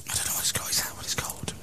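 hushed unvoiced whisper; conspiratorial whisper among friends. Real, not acted. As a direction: whisper so they can't hear. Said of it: "Shall we whisper so they can't hear?".

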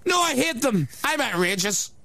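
hammed-up Northern Irish radio host voice; mock Northern Irish accent. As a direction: play a brash Belfast shock jock with a shaky Northern Irish accent. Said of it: "The accent's all over the place".

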